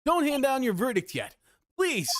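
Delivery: high, urgent plea to the judge; desperate courtroom objection; pleading defense lawyer. Acted. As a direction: beg the court to wait. Voicing Phoenix objecting in game.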